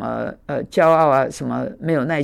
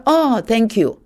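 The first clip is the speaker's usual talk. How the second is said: roommate thanks; 7 semitones higher, much slower than usual. Retold or imitated. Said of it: "他说".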